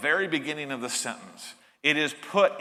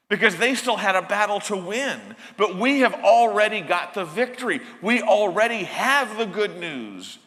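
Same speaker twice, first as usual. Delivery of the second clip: rising triumphant preaching; 5 semitones higher, 4 dB louder than usual. Real, not acted. Preacher building to triumphant climax.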